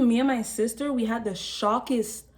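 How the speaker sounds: ordinary talk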